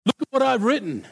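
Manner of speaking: a brisk Australian God scolding; Aussie God. Retold or imitated. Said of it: "God's an Aussie".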